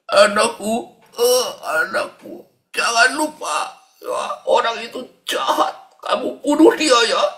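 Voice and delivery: hammed-up dying father urging murder; mock dying parent, high and insistent; vengeful dying parent. Dying parent urges revenge.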